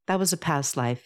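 plain reading voice

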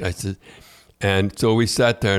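ordinary delivery

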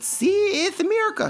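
mock South American accent. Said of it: "It's my South American accent".

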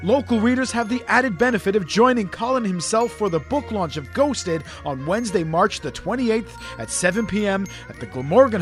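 smooth promo voiceover read. Acted. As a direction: read it like a book advert. Ad read over music.

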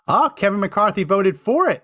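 surprised. Real, not acted. Spots McCarthy on the list.

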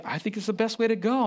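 high, carefree daughter voice; daughter's breezy answer. Retold or imitated. Daughter telling her dad she loved the missile alert, high and breezy. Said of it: "it's like".

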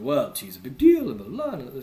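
lightly imitated British accent; a rough go at sounding British; attempted British accent. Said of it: "trying to do my British accent".

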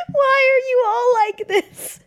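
high, slow anguished cry; exasperated wail. Retold or imitated. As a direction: wail it out in despair. Retold exasperation at coworkers.

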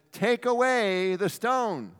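slow, high, loud command; commanding Jesus. Acted. Jesus ordering the tomb opened, slow and commanding. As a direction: order the stone moved with authority.

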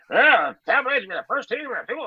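high, fast mocking parrot of his friend; mocking imitation. Mocking a friend's boast back at him in a silly voice. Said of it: "shut your mouth off and said".

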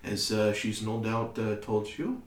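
lightly voiced, accented courteous son; polite [name]. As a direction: speak haltingly and politely in accent.

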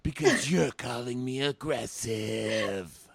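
high, slow, put-on hushed voice; hammed-up passive-aggressive whisper; mock whisper. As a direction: fake a calm whisper while seething. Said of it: "Kenny's like".

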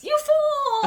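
squeaky high little kitty; kitten voice. Retold or imitated. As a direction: shriek it as a tiny angry cat. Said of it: "in her little kitty voice".

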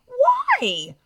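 indignant, high-pitched challenge; exasperated. Real, not acted. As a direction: leap up high on an incredulous question. Exasperated answer to retold threat.

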